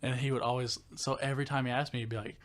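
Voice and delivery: usual speaking voice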